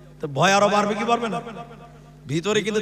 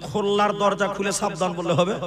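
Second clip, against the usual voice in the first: ordinary talk, nothing marked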